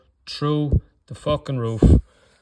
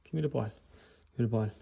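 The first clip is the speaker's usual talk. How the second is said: a timid player half-heartedly asking for the ball; weak call for the ball; 9 dB quieter than usual. Retold or imitated. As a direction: call for it like you don't want it. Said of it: "and they go".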